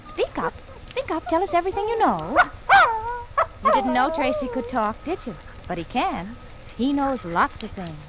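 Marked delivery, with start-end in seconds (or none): 0.0-5.3 high, eager coaxing to a pet; urging the dog. Acted. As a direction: egg the dog on in a lifted voice.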